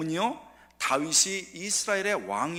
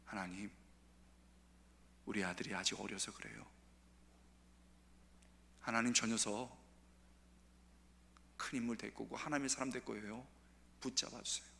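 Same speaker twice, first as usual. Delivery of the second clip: tearful prayer; 5 semitones lower, 13 dB quieter than usual. Retold or imitated. Father praying for his son, soft and pleading. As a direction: pray quietly for a wayward son. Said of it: "아버지가".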